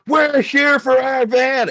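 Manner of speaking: high, loud, drawn-out announcement; loud demand. Retold or imitated. Mentor to border staff at the counter, loud high demand. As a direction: proclaim it so the whole line hears.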